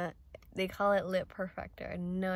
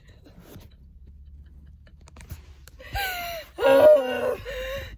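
shrieking laugh; 6 dB louder than usual. Real, not acted. Sister calls it a hard watch.

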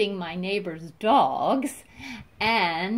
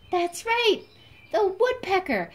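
much higher, louder cheer for the answer; excited praise; 11 semitones higher, 6 dB louder than usual. Acted. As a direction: cheer like a delighted teacher. Praises child's guess excitedly.

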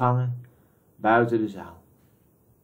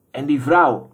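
sharp, louder urgent question; short and urgent; 4 semitones higher, 6 dB louder than usual. A narrator's reading. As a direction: demand it curtly. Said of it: "vroeg kort en dringend". (kort en dringend gevraagd)

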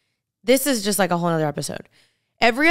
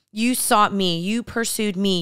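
usual speaking voice

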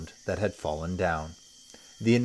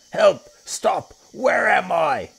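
much higher, louder dazed cries; panicked crocodile; 9 dB louder, slower than usual. Acted. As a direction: yell while tumbling head over heels. Crocodile tumbling over the ground.

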